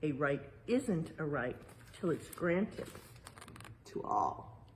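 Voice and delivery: usual speaking voice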